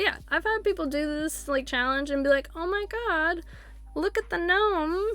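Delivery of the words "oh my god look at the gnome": higher, drawn-out mock wonder; imitated amazement. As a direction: imitate an awed spotter, stretching it out. Imitates players spotting a gnome.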